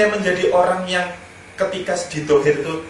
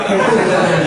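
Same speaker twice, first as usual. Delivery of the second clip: burst of laughter; 7 dB louder than usual. Real, not acted. Laughing at wife's reply.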